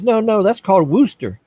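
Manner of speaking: mock a local setting him straight; mock local correcting. A Massachusetts local correcting the truck driver. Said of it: "He goes".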